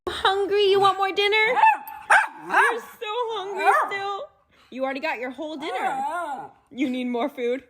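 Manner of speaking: put-on baby talk. Talking to her dog at dinner.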